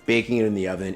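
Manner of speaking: ordinary talk, nothing marked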